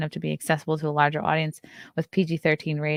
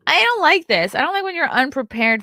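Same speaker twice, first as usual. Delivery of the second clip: high, loud disgusted groan; 9 semitones higher, 5 dB louder than usual. Real, not acted. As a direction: recoil from it loudly. Disgust at scrolling ad.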